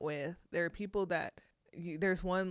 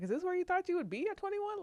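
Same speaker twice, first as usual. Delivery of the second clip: stern parole officer; 13 semitones higher, faster than usual. Retold or imitated. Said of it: "he was like".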